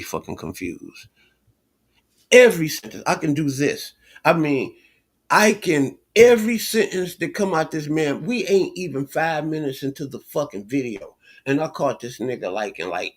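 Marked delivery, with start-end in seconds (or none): 2.3-3.0 louder, higher cry of every sentence; frustrated loud outburst; exasperated outburst. Real, not acted. Ranting at debater, exasperated. 4.2-10.7 higher, louder indignant rant; worked-up indignant rant; heated rant. Real, not acted. Heated rant against debater.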